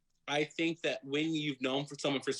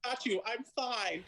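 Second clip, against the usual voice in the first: higher, brave I got you, I'm fine; forced cheer; 9 semitones higher than usual. Retold or imitated. Cried-out actress insisting to director she's fine, high and chirpy. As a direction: paper over the tears brightly. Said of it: "retold reply after crying".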